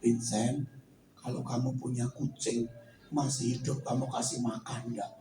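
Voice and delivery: the Lord speaking low and soft to Vincent; voice of God. Acted. Holy Spirit whispers.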